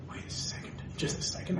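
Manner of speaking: hushed, sneaky whisper; conspiratorial whisper; scheming whisper. Acted. Sneaky plan to get billion.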